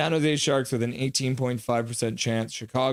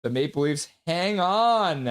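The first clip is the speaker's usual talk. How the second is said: measured final-whistle call, slightly raised; play-by-play call; 5 semitones higher than usual. Real, not acted. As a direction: call the win, holding back the big shout. Calls the final moments of win.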